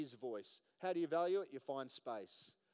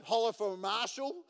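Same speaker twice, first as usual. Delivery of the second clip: hollering the ad slogan, much higher and louder; mock ad holler; 7 semitones higher, 10 dB louder than usual. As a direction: belt it out like a cheesy TV ad jingle. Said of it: "you know that ad?".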